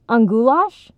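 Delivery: rising, surprised interruption; interrupting question. A narrator's reading. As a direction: cut in with an eager question. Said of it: "Jake interrupted".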